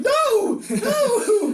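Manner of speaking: very high, loud comic scream; hammed-up horrified cry; mock terrified shriek. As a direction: scream it like a panicked girl. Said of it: "And she's like".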